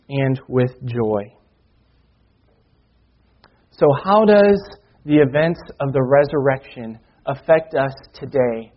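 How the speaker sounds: ordinary talk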